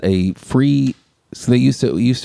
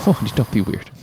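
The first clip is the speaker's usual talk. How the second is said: softer, offhand mock dismissal; dismissive; 2 semitones lower, 4 dB quieter than usual. Player trying out the movie wife's line, dismissive. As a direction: brush them off quietly, as a quote. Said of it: "It's so dismissive".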